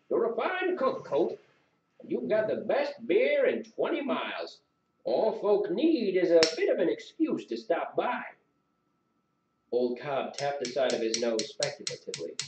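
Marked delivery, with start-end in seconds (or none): 0.1-8.2 put-on high, lilting old-timer voice; butter up the innkeeper with praise; animated, swooping folksy pitch; old regular, coaxing. A narrator's reading.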